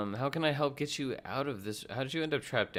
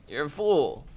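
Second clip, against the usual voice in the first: loud taunt, higher pitch; loud mocking taunt; 6 semitones higher, 9 dB louder, slower than usual. Real, not acted. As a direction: jeer at the enemy. Taunting enemy, +8 dB.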